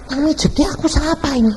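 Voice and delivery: high-pitched acted bewilderment; startled question. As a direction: imitate a woman puzzled and alarmed, high up. Woman startled by unknown hands. (pertanyaan kaget bernada tinggi)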